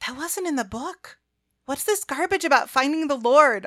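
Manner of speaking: high-pitched, annoyed protest; indignant. A narrator's reading. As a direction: object in affronted disbelief. Indignant complaint about changed story.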